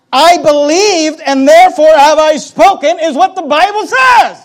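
slow, high, full-force shout; shouted preaching. Acted. Preacher declaring what the Bible says, shouted at full force. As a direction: shout the verse out slowly to the room.

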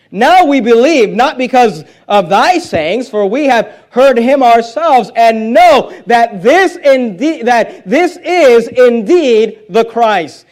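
slow, weighty pulpit proclamation; declamatory scripture preaching. Acted. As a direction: proclaim each phrase deliberately and firmly. Preacher declaims the men's verse.

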